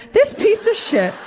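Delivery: high-pitched comic outrage; indignant outburst. Acted. Oldest child condemning the younger brother, indignant and high.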